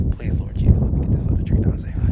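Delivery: fast hushed whisper to God; whispered prayer. Real, not acted. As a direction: whisper the plea quickly. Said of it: "God, please".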